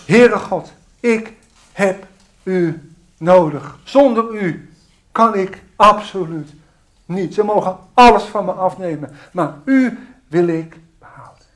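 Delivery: loud, earnest plea to God; fervent loud prayer. Real, not acted. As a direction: pour it out with full force. (vurig luid gebed)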